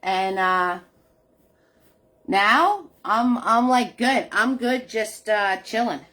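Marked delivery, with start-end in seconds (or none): none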